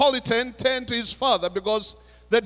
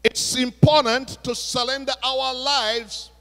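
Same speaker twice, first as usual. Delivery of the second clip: raised, emphatic preaching to the congregation; 6 semitones higher, 6 dB louder than usual. Acted. Pastor challenging his congregation, preaching with force. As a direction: drive the challenge home from the pulpit.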